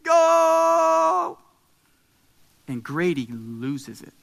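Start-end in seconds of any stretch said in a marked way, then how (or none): 0.0-1.4 loud, high "Goooooal" on one note; sustained celebratory shout; long-held goal cry. Retold or imitated. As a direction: belt out a drawn-out soccer goal call. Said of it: "as long as I could hold it out".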